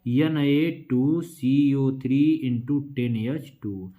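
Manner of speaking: spelling the formula very slowly; slow dictation. Shown as a demonstration. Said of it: "इसका सूत्र क्या हो जाएगा?".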